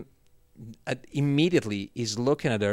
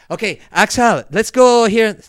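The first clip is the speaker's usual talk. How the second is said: louder, higher, breezy; imitating Nacho, casual; 11 semitones higher, 13 dB louder than usual. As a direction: brush it off lightly.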